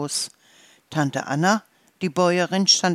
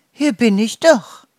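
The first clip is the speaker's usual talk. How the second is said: high, loud answering call; wife calling back; 6 semitones higher, 8 dB louder than usual. A narrator's reading. Wife answers from the kitchen, calling back. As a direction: sing out a reply from indoors.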